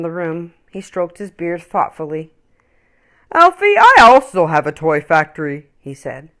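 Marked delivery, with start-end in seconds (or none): 3.3-5.7 a big man greeting warmly; kindly big man. Acted. Big man's offer.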